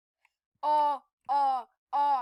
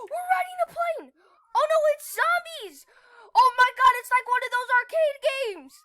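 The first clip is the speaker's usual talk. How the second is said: high, fast excited shouts; thrilled gamer; 8 semitones higher, 9 dB louder than usual. Real, not acted. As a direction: gush over the ride. Amazed on plane ride.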